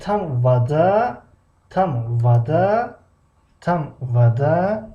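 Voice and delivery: slow question with the voice rising on вода. Shown as a demonstration. Teacher modelling a German question for the class, slow and rising. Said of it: "Як нуқта бас аст".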